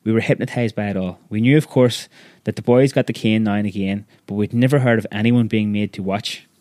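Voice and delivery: usual speaking voice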